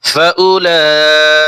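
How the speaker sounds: "ফাউলাইকা" with লা held very long; four-alif madd. Shown as a demonstration. Teacher models the rule, "ফাউলাইকা" with a long chanted লা. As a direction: stretch the লা of "ফাউলাইকা" on a level note. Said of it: "চার আলিফ টান হবে".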